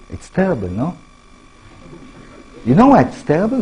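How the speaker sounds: ordinary talk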